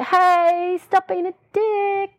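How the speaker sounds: high, drawn-out mild plea; mock friendly plea. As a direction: coax the neighbour in a high, singsong mock plea.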